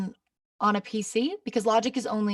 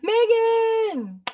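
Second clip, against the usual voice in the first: excited calling out; 8 dB louder than usual. Real, not acted. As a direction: call the name out high and loud. Announcing the giveaway winner.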